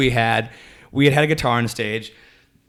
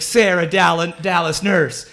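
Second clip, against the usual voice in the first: higher-pitched, searching chant; lost volunteer imitation; 5 semitones higher, much slower than usual. Retelling blindfolded woman left alone on stage, searching chant. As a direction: mimic her groping about while still chanting. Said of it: "she's, like, reaching around trying to find us".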